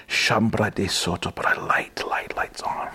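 whispered murmur of tongues; hushed private prayer whisper. Real, not acted. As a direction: breathe it out under the voice.

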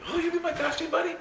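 high, fast, gushing friend voice; mock gushing delight; excited. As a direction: squeal like an overjoyed new believer.

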